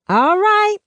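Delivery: very high, loud holler; calling back. Acted. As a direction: sing it out across the yard. Neighbor calls back across the street.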